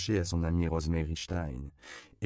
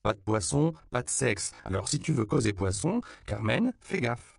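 high, emphatic reprimand; stern warning; 6 semitones higher, 4 dB louder than usual. A narrator's reading. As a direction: punch out each rule in a raised pitch. Warns the aunt, repeating her rule.

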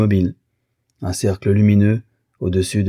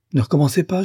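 much higher, sharp rebuke; indignant interruption; 9 semitones higher, faster than usual. A narrator's reading. Wife stops her husband's oath, indignant. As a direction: cut in with raised pitch.

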